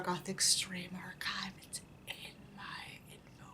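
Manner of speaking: soft whispered plug; real whisper aside. Real, not acted. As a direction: whisper the name like a secret. Whispered aside about channel.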